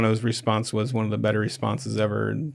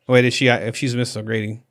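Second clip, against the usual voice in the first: normal talking voice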